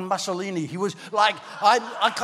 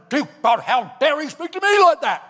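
higher and louder, offended protest; indignant outrage; 6 semitones higher, 4 dB louder than usual. Retold or imitated. Young man complaining to God about the pastor, indignant. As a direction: bristle with wounded pride. Indignant prayer after rebuke retold.

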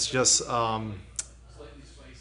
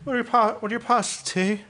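mock British accent; 7 semitones higher than usual. Mocking British accent bit.

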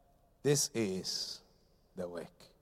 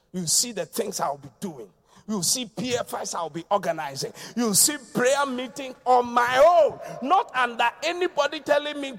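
normal talking voice

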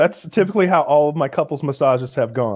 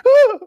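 loud high yelp with a laugh; startled yelp; 7 dB louder than usual. Retold or imitated. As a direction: yelp as if yanked. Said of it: "And George is like".

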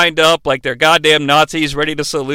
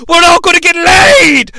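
louder, higher party shout; mock drunken yell; 9 semitones higher, 6 dB louder than usual. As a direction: holler it to the whole room. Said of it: "Dudley Moore just yelled".

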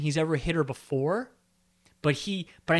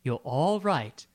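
calming Meredith; 3 semitones higher than usual. Retold or imitated. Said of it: "he goes".

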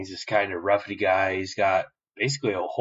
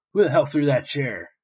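higher, louder bratty demand; mock angry demand; 6 semitones higher, 4 dB louder than usual. Spoiled son demands who threw the chair, mock-angry and raised. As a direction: snap it out like a spoiled brat.

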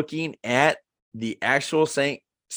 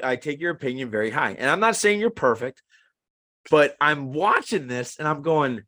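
ordinary talk, nothing marked

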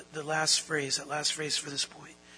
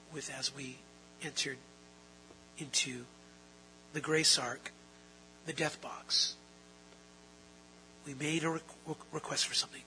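hushed, fading; 4 semitones lower, 8 dB quieter than usual. Acted. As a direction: let each word sink, softer and softer. Slows and fades into a hush.

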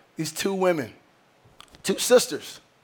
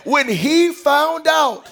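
full preaching; 7 semitones higher than usual. Acted. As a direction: preach it to the congregation, building.

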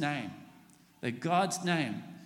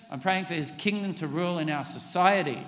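usual speaking voice

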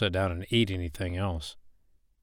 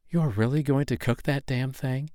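incredulous; 6 semitones higher than usual. Acted. Woman balks at cooking the snake.

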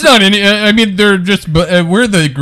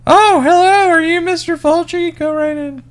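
high, bright-pitched front-desk greeting; chirpy receptionist; 9 semitones higher than usual. Retold or imitated. Receptionist greeting the horror director, in a high chirpy voice. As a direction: play a perky receptionist waving a guest through. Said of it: "so she goes".

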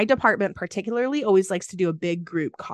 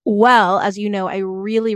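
ordinary delivery

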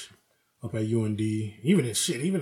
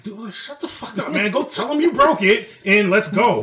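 exasperated scolding; 8 semitones higher, 5 dB louder than usual. Retold or imitated. Scolding the panicking coworker.

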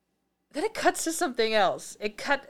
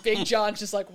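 ordinary delivery

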